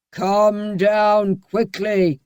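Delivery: very slow, drawn-out command; slow menacing shout. A narrator's reading. Bluebeard shouting up the stairs. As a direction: drag out the threat. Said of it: "shouted Bluebeard".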